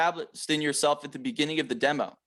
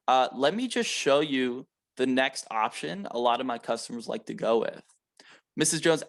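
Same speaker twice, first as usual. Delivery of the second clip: slow and a little softer, calm and unhurried; slow, soft sales pitch; 4 semitones lower than usual. Shown as a demonstration. Rep to Missus Jones over budget, slow relaxed pitch. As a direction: talk the customer down gently, no pressure. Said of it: "You guys notice how slowly I was talking?".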